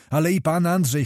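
ordinary delivery, nothing marked

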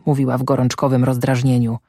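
usual speaking voice

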